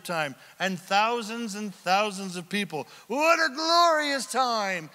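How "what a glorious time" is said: glorious awe. Acted. Preacher exulting over worship scene.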